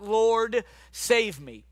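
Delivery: understated cry for help. A narrator's reading. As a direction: call out a restrained plea for rescue. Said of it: "he cried out".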